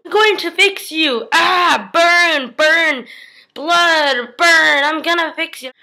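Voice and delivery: high, loud, slow chant of a torturer; menacing father. Acted. Cruel father torturing his daughter, slow loud menace. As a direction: drag out each burn like a twisted ritual.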